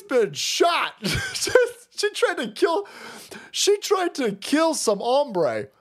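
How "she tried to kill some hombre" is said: usual speaking voice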